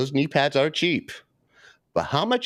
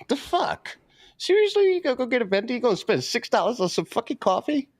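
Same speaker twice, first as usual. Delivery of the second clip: much higher, incredulous seriously; jeering at viewers who buy expensive coffee, incredulous; mock disbelief; 10 semitones higher than usual. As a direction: scoff at the coffee habit in disbelief. Mocking viewers buying coffee.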